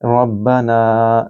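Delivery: रब्बना with the ना stretched long. Shown as a demonstration. Teacher isolating the word, very slow recitation. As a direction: drag out the ना in रब्बना, very slowly. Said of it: "नून को लंबा करें".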